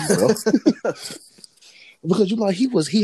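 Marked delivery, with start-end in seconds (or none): 0.1-1.0 laughing. Real, not acted. Laughing at his own danger.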